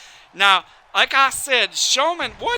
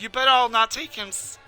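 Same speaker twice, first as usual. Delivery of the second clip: normal talking voice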